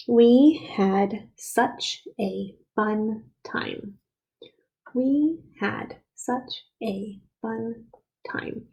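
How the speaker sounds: very slow, word by word; slow dictation. Shown as a demonstration. Teacher dictating a new sentence to the class, very slowly. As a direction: read it out slowly for young writers. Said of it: "it's going to say".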